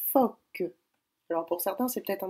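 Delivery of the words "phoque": phoque said higher and louder; dictated word. Shown as a demonstration. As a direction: give the dictation word clearly. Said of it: "c'est le mot". (phoque articulé, plus fort)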